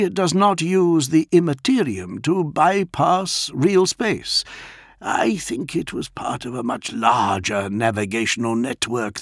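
polite high-pitched Warsmith addressing his lord; Dantioch's voice. A narrator's reading. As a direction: speak up high and careful as the loyal Warsmith. Dantioch explains device.

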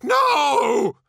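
high, loud cry of denial; drawn-out panicked scream; terrified shout. A narrator's reading. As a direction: shout in desperate protest. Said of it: "shouted Eidolon".

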